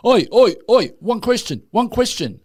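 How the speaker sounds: high, loud "Oi, oi, oi" hail; mock heckler calling out. Comedian retelling, voices a fan calling out in the street, high and loud. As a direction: grab attention like a street heckler.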